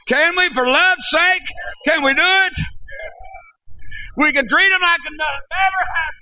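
high, impassioned pulpit cry; full-force preaching. Real, not acted. Preacher asking church to receive the returning one, high pleading shout. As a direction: push every phrase high, pleading with the crowd. Exhorts congregation at altar call.

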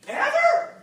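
very high squeaked question; mock-astonished squeal; incredulous. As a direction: pitch it way up in disbelief. Retold disbelieving question.

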